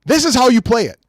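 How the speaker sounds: high-pitched, heated rant; mad. Real, not acted. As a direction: vent at the reviewers. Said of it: "and I'm sorry I'm mad".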